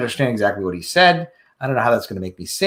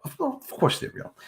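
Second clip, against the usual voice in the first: whispered aside; 9 semitones higher, 9 dB quieter than usual. Acted. Sarcastic aside.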